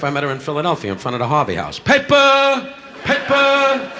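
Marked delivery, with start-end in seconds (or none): 1.8-3.9 high, loud hawker's cry; street vendor call. Retold or imitated. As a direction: bark it like a paperboy. Newspaper seller calling out.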